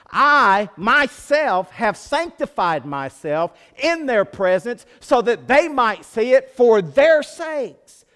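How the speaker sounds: loud, high, slow voice of Jesus praying; fervent prayer. Retold or imitated. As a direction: plead with the Father. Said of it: "Jesus is saying, Father".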